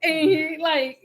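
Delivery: usual speaking voice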